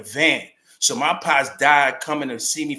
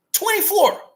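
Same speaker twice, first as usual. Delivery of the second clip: amazed echo; 6 dB louder than usual. Real, not acted. Echoing in disbelief.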